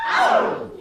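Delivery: shrieked "ow". Shown as a demonstration.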